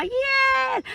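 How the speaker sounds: a high, bright fake "Yes"; fake cheer. Jealous listener pretending joy with a high yes. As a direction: fake the enthusiasm on top. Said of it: "your outside looking all".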